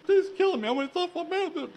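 high, far-off wailing sob; crying plea. Retold or imitated. Crying boy begging the mean neighbour Miss, high sobbing wail. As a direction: blubber it out like a terrified child. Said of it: "and I'm crying".

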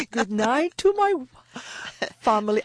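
a playful hint of a Venezuelan boxer dedicating a win. Guest on radio saying goodnight, mock boxer's shout-out. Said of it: "now I sound like the Venezuelan boxers".

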